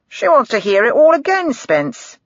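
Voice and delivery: mock her request to his colleague; sarcastic. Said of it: "he said sarcastically".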